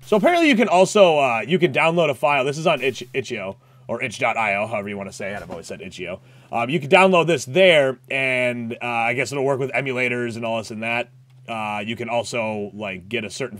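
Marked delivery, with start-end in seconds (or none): none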